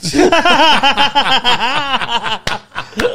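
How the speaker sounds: loud, high burst of laughter. Real, not acted. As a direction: crack up at your own joke. Laughs at his own punchline.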